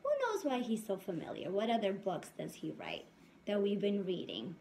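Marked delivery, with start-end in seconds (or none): none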